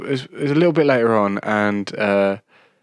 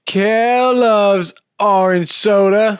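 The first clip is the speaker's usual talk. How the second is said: much higher, slow Kel voice; Kel catchphrase; 10 semitones higher, 5 dB louder, much slower than usual. Shown as a demonstration. Cohost answering the cue, high slow Kel voice. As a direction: sing it out like Kel. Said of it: "We'll do it again".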